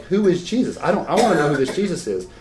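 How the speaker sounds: a hint of a small child crying out her question; little girl pleading. Young girl in bed asking her parents, mildly tearful and pleading. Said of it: "she cried in bed because she's like".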